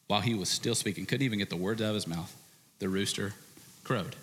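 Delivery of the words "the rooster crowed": ordinary talk, nothing marked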